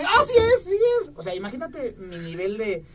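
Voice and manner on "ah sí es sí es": very high, loud thrilled "sí es"; mock giddy squeal; excited disbelief. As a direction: squeal it, can't believe it's her. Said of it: "Y yo así de".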